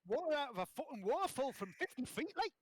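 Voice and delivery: high Geordie accent; hammed-up Geordie boast, pitched well up. Shown as a demonstration. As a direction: brag in a broad, high Geordie voice. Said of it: "my phrase for Geordie".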